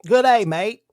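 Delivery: loud Australian-sounding attempt at English; badly faked English that lands Australian; failed English accent. Trying English accent to charm customers, comes out Australian. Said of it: "I couldn't do an English accent".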